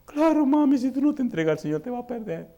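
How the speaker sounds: high pleading daughter; imitating a tearful daughter begging her mother; daughter's voice.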